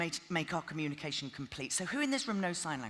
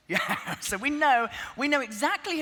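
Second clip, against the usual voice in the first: laughing loudly as if over music; 8 semitones higher, 9 dB louder than usual. Shown as a demonstration. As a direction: laugh and shout over the noise. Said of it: "and I went".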